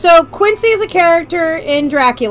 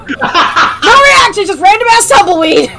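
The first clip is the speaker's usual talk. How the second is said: laughing; 7 semitones higher, 6 dB louder, slower than usual. Real, not acted. Laughing through the joke.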